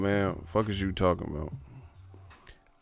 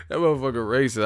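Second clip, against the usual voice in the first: normal talking voice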